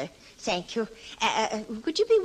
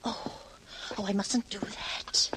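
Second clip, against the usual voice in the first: softer, breathless self-warning whisper; a frightened whisper, softer; whispered to herself; 2 semitones lower, 8 dB quieter, slower than usual. Acted. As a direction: hush yourself, barely voiced. Landlady scolds herself alone.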